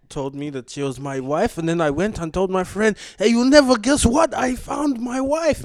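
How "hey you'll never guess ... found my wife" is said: mock excited. Retelling guy telling his friend.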